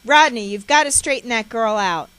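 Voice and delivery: loud harsh demand. Retold or imitated. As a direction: bark the order at Rodney. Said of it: "She continues to speak harshly to him".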